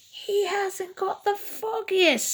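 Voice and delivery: high, put-on snicker of a scheming farmer. A narrator's reading. Farmer Bluster snickers about Farmer Big's mousetrap, high and sly. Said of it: "snickered Farmer Bluster".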